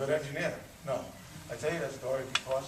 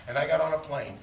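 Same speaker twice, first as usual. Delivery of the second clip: usual speaking voice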